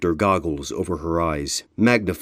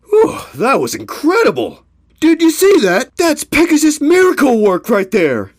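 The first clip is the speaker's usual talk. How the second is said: much higher, loud thrilled voice; excited; 8 dB louder than usual. Acted. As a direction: gush with amazement. Ponies cheering after the crossing.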